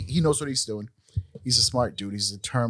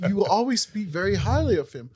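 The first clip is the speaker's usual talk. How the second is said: wife's line pitched up and louder; high mock wife; 9 semitones higher, 4 dB louder than usual. Husband quoting his wife again, high mock voice. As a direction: voice the wife, higher. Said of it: "She's like".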